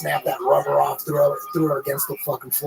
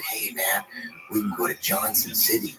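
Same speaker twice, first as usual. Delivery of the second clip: whispering; 6 semitones lower, 6 dB quieter than usual. Retold or imitated. Friend tips him off at club.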